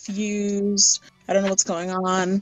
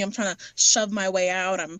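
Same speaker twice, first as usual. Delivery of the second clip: usual speaking voice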